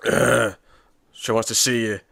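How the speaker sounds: mock grunting delivery; grunty. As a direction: imitate a grunty heavy. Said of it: "She's just all grunty".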